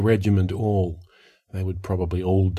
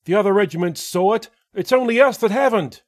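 high, fast urgent report; 13 semitones higher than usual. A narrator's reading. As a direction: rush the plea, pressing hard. Courier pressing his report.

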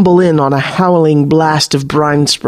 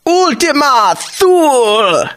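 high, slow ringing command; 11 semitones higher, slower than usual. Acted. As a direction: call down the spell with full authority. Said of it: "she commands".

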